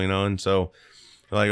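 normal talking voice

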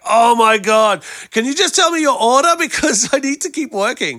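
high, loud exasperated plea. Retold or imitated. Cousin at KFC drive-through to pranking relative, exasperated. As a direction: imitate a fed-up worker, pitched right up.

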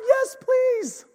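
very high, drawn-out begging; mock eager plea. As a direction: beg with hammed-up eagerness. Eagerly agrees to Jesus leaving.

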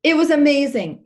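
high, loud, thrilled exclamation; real amazed excitement. Real, not acted. Amazed climax of her story.

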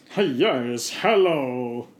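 effusive comic welcome; hammed-up greeting. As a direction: greet a visitor with theatrical gusto. Leonardo greets visitor in scene.

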